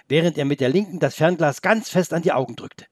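ordinary delivery

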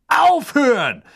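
high, loud, desperate shout; urgent, alarmed yell; screamed command. A narrator's reading. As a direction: scream at them to stop. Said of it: "schrie eine Stimme".

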